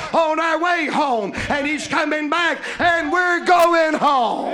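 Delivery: loud, building preacher's refrain; full-force preaching. Acted. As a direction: drive the congregation toward the shout.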